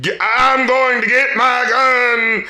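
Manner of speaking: slow, stretched, hammed-up Southern hook; mock Southern drawl. Co-host mocking a hated hook, hammed-up Southern drawl. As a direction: mimic a crunk hook in a fake Southern drawl. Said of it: "those awful accents".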